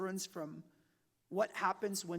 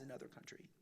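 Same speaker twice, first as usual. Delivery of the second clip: whispered aside; 5 semitones lower, 16 dB quieter, faster than usual. Real, not acted.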